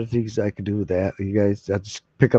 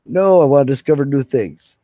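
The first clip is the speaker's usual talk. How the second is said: ordinary talk, nothing marked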